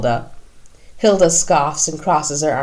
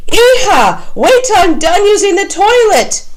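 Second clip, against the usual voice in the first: much higher, loud yell through a door; 7 dB louder than usual. A narrator's reading. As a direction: shout from the bathroom to hurry off. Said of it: "a man yells out".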